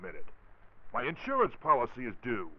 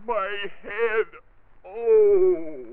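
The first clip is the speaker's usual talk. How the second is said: groaning in pain; 9 semitones higher, 8 dB louder, much slower than usual. Acted. Struck on the head.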